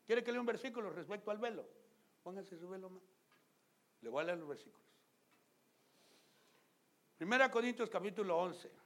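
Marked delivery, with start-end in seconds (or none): none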